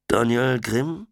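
langsam, schläfrig gemeldet; müde Stimme. A narrator's reading. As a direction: wie eben aus dem Schlaf geholt. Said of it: "meldet sich eine müde Stimme". (verschlafen am Telefon)

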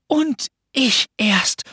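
high, drawn-out mock distress; shaken murmur. A narrator's reading. Pünktchen outdoes Anton's sorrow, murmuring high and shaken. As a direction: stretch it out as if devastated. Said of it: "murmelte sie erschüttert".

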